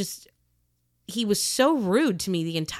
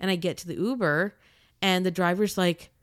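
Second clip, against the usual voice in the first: usual speaking voice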